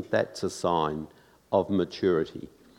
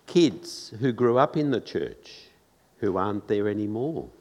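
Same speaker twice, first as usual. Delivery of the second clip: ordinary delivery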